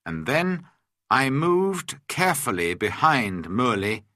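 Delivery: plain reading voice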